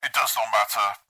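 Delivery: stern growl through a helmet. A narrator's reading. Chaplain to a confused Marine, growling. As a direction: growl it, dismissing the doubt. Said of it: "Veritas growled".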